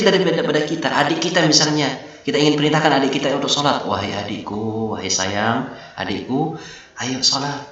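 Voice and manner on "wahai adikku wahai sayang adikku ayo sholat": softer, slow, loving coax; teacher modelling a sweet invitation to prayer; gentle coaxing. Shown as a demonstration. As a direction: coax little brother to pray tenderly. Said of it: "gunakan bahasa yang lembut".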